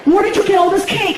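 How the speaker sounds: put-on high, indignant girlfriend voice; shrill her up in disbelief; mock girlfriend. Girlfriend confronting him over cakes, high and indignant.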